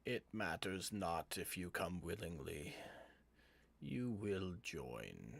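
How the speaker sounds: ominous villain, slowing heavily at the end; sinister possessed voice. Acted. Possessed George tells the reluctant party they will join, sinister voice. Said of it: "And as he says that".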